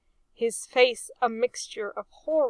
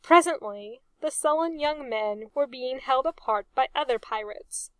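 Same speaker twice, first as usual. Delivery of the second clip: plain reading voice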